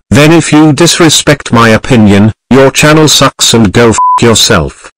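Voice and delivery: loud furious outburst at the viewers; angry yelling. Acted. Ranter snapping at viewers who disagree, loud angry yell. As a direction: bark it at anyone who disagrees.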